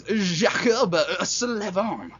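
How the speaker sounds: slow, drawn-out put-on announcer voice; silly voice. Vlogger naming the team's player, slowly, in a goofy put-on voice. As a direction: stretch the name out in a goofy voice. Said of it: "I don't know why I'm doing these voices".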